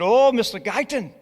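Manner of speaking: high, loud, joyful mock Scottish; exuberant, badly faked Scottish voice; bad Scottish accent. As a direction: imitate an overjoyed Scottish student proclaiming news. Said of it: "I have a brutal Scottish accent".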